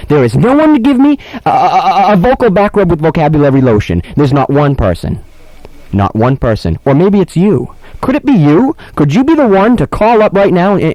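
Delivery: milk the mock despair; mock worked up. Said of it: "I'm getting all worked up".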